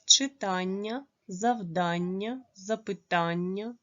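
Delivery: slow reading of "Читання, завдання, запитання", stressed syllable drawn out; marked word stress. Shown as a demonstration. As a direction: read "Читання, завдання, запитання" slowly, stretching each stressed "а". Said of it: "на їх наголос". (повільно, з виразним наголосом: "Читання, завдання, запитання")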